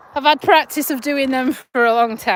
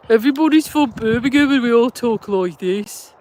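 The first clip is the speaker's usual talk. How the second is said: put-on Brummie accent; Birmingham accent. Shown as a demonstration. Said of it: "I can do Birmingham accents".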